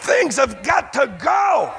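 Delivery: full-force revival shout; fiery preaching. Acted. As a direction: drive it home to the congregation.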